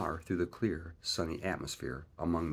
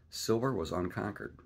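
usual speaking voice

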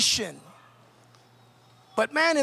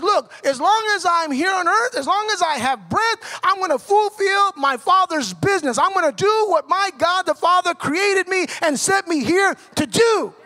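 raised, driving preacher's urgency; urgent; 4 semitones higher, 5 dB louder than usual. Retold or imitated. Jesus telling disciples of his Father's business, urgent. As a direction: press it home with resolve, pitch held high. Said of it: "He's communicating to the disciples".

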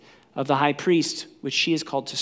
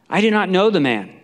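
loud, higher emphatic denial; sworn denial; 5 semitones higher, 7 dB louder than usual. Acted. Peter denying Jesus with an oath, loud and emphatic. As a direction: swear it forcefully. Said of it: "this time with an oath, he swears".

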